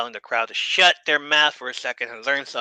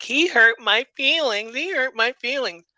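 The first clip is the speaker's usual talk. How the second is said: hammed-up whiny crybaby; mocking whiny voice; 10 semitones higher than usual. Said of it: "he changes his voice to mock".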